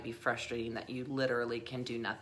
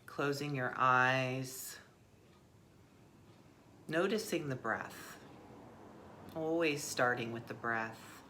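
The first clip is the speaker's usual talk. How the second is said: soft, unhurried guiding voice with long pauses; guided meditation; 3 semitones lower, 8 dB quieter than usual. Acted. Teacher leading listeners into the breath, guided meditation. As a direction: ease listeners inward, softly and gently.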